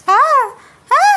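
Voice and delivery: two sharp loud screams; loud frightened screams. Acted. Ananya screaming at a cockroach, sharp frightened cries. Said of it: "అని అనన్య గట్టి గట్టిగా అరుస్తోంది".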